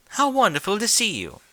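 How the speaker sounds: Rarity welcomes Rainbow to her boutique, gushing; delighted Rarity. Acted. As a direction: gush with theatrical delight.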